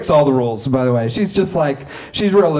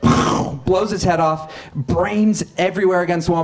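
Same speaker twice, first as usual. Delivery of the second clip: normal talking voice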